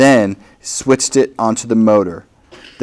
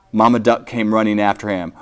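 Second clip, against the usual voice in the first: ordinary delivery, nothing marked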